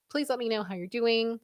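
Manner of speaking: usual speaking voice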